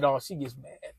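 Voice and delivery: lightly voicing his laid-back mother; a son imitating his mom dismissing the pregnancy in a low, soft voice; mom's voice. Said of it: "She said".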